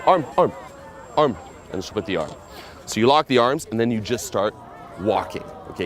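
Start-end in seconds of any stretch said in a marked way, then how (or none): 0.0-1.4 urgent. Shown as a demonstration. Pickup coach getting a girl to link arms, urgent. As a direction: press her for the arm.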